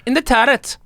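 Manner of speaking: put-on high, loud Liverpool accent; hammy Scouse impression. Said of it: "do a northern accent".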